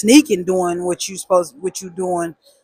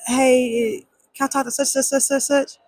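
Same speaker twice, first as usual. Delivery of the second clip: nervous caller; 6 semitones higher than usual. Retold or imitated. Calling on phone, nervous.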